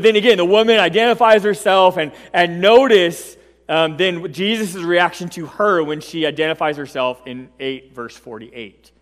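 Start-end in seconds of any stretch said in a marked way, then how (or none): none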